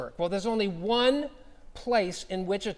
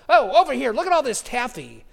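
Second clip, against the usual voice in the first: higher and louder, eagerly pointing things out; excited invitation; 4 semitones higher, 6 dB louder than usual. Retold or imitated. Old lady showing the pastor the taffy, excited and raised. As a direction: call him over to the taffy with delight.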